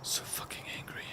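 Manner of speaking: a breathy whisper of pent-up anger. Real, not acted. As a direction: whisper it through your teeth, seething. Keeps whispering his frustration.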